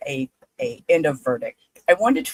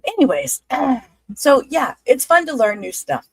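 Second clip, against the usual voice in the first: higher, louder, voice crying; pushing through tears; 9 semitones higher, 6 dB louder than usual. Real, not acted. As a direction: force the words past the crying. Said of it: "I'm not gonna cry today".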